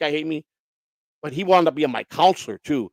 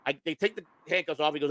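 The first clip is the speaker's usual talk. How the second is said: ordinary talk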